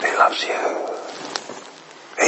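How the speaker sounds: "He loves you" whispered very slowly, drawing out "loves". Shown as a demonstration. Preacher lingers over the spiritual truth for the congregation, slow whisper. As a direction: let "He loves you" linger in a long hush. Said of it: "all you need to hear".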